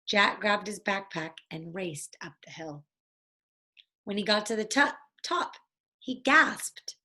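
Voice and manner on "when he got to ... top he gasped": usual speaking voice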